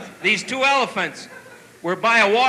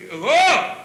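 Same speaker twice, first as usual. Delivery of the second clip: surprised whoa; 6 semitones higher, 3 dB louder than usual. Acted. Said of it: "his buddy says to him".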